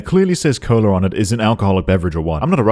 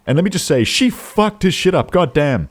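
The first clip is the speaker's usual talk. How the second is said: ordinary talk, nothing marked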